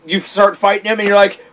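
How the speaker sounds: normal talking voice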